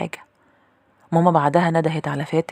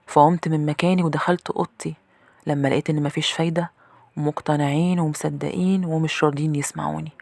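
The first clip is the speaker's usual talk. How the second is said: usual speaking voice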